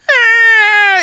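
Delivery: high loud strangled cry; pained ah. Real, not acted. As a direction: wince out loud. Imagining baby pain.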